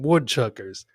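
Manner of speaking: ordinary talk, nothing marked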